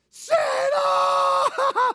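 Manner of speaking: a huge, high joyful yell; ecstatic shout. Retold or imitated. Elf hearing the news, shouting the name in joy. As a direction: scream the name like a kid who can't believe it. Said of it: "Remember Elf's response?".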